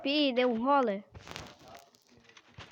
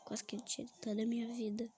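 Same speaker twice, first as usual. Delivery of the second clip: whisper; 13 dB quieter, faster than usual. Real, not acted. Aside after a near miss.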